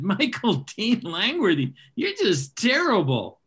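a hint of a mother's fond scolding. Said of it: "she always said".

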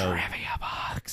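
whispering. Acted. Whispered segment jingle.

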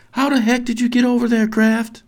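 fast, high, loud shout; yelling over. Acted. Relieved mother to boy on the island, yelling. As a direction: yell the question across the water. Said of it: "Angela yells over to him".

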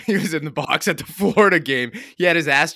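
laughing through the words; giggling while telling it. Real, not acted.

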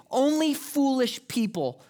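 ordinary talk, nothing marked

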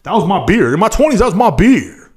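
ordinary talk

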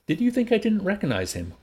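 high-pitched indignant question. A narrator's reading. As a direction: fire it back, affronted. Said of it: "Linda asked indignantly".

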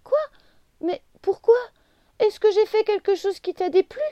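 high, loud, tearful cry; distressed, near tears. A narrator's reading. Little leaf begging her friend to explain, high and tearful.

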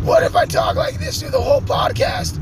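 scratchy put-on voice. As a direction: ham up a croaky host reading the outro. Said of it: "I got enough scratchy voices".

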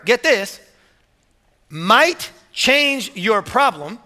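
ordinary talk

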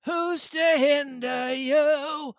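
put-on high, slow old castaway voice; Ben Gunn voice. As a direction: lift into a cracked old sailor. Ben Gunn voiced in read story.

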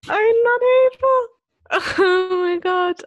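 high, loud crying-laughter; laughing hard. Real, not acted. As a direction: squeal it through helpless laughter. Overcome laughing at sister story.

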